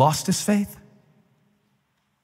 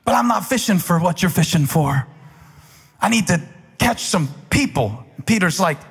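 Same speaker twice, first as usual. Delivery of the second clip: full-force preaching; 9 semitones higher than usual. Acted. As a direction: preach it to the back row, rising and hammering.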